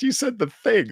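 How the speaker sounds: ordinary talk, nothing marked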